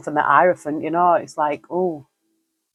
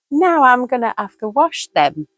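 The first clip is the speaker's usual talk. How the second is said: pet voice; 8 semitones higher than usual. Real, not acted. Mock complaint to the cat.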